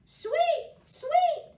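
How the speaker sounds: high bird tweet; bird chirp. A narrator's reading. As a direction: chirp it like a songbird. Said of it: "said Bird".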